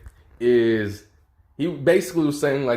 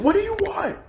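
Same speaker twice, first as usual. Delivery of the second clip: mock scream. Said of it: "he's just screaming".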